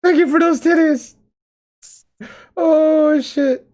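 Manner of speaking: high, squeaky laugh-talk; laughing hard. Real, not acted. Host thanking Plague Doctor, helpless with high laughter. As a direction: gasp the words out through real laughter. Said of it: "I can hear you laughing in there".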